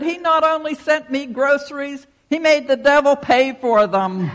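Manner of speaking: high, loud gleeful crow; triumphant. Acted. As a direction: deliver the punchline in triumph. Triumphant old lady's punchline.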